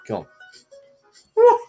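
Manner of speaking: usual speaking voice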